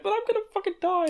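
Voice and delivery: very high, quick panicky imitation; hammed-up high-pitched fright; mock terror. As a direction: squeak it like someone dreading death. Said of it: "absolutely terrified that she's gonna die".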